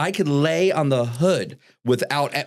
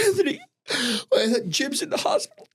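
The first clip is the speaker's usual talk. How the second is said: mock sobbing mom, pitched way up; hammed-up tearful mother, voice high and breaking; high crying mom voice; 14 semitones higher than usual. Son imitating his crying mother on the phone, high and tearful.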